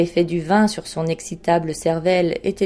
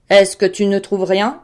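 loud impatient question; 6 dB louder than usual. A narrator's reading. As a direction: press him for an answer. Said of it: "dit le roi impatienté". (question impatiente, voix forte)

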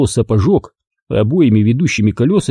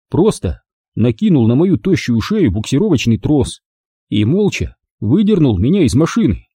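usual speaking voice

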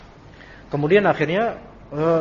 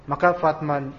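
ordinary talk

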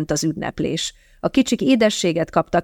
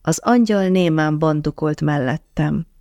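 ordinary delivery, nothing marked

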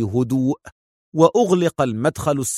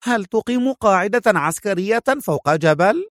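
high-pitched woman's surprise; surprised female character voice, raised; astonished question; 7 semitones higher than usual. A narrator's reading. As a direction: ask in amazement, pitch lifted. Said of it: "سألته في دهشة".

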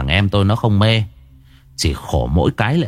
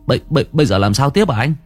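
relieved, flustered, slightly raised voice; flustered younger brother; 2 semitones higher, faster than usual. Acted. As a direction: stammer hurriedly to your older brother.

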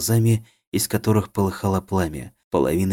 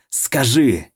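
louder, higher menacing command; dark lord; 5 semitones higher, 5 dB louder than usual. A narrator's reading. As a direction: bark the order. Possessed man demands answer.

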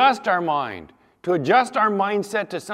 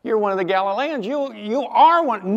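higher, pointed accusation; insistent accuser; 5 semitones higher, 5 dB louder than usual. Retold or imitated. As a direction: pin Peter down with each "you are". Accuser pressing Peter.

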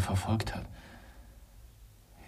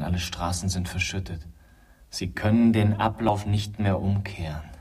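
ordinary delivery

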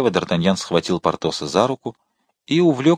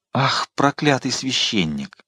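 bitter exclamation; 3 semitones higher, slower than usual. A narrator's reading. Queen cursing the departed priest, slow bitter exclamation. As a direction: draw the curse out with restrained anger. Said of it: "воскликнула Анна Австрийская".

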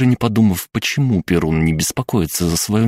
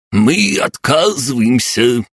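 put-on high, drawn-out bark of a stooped old man; old man's bark; 8 semitones higher, 4 dB louder, slower than usual. A narrator's reading. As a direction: bark it out slowly as a protesting old codger. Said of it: "вдруг гаркнул согнувшийся старикашка". (выкрик сварливого старикашки)

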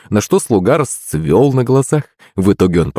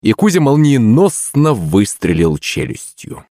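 ordinary delivery

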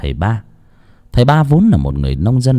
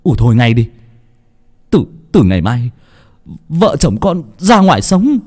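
firm shout; 3 semitones higher than usual. A narrator's reading. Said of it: "Vinh quát lớn".

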